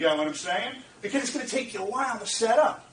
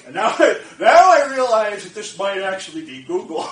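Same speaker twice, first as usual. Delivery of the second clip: loud laughing realisation; loud delighted laughter; laughing excitement; 11 dB louder than usual. Real, not acted. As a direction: burst out laughing in disbelief. Realising the call is real.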